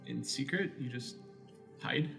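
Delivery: ordinary talk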